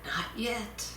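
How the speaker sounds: soft calm answer; quietly. Acted. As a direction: say it quietly, unbothered. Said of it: "said quietly".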